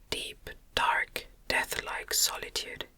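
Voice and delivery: plain reading voice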